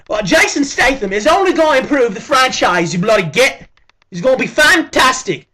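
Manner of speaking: hammed-up British accent calling him a git. Vlogger mocking his friend in a British accent. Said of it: "I'm using this accent".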